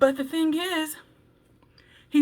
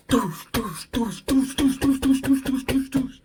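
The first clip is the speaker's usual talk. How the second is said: mock thudding footsteps; running footsteps; 4 dB louder than usual. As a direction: stomp the beat of feet running.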